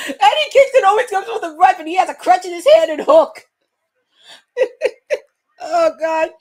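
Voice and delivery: talking while cracking up at Eddie; laughing. Real, not acted.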